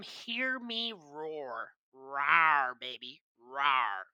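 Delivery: low playful growl; playful monster growl. Pretend. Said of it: "I am random".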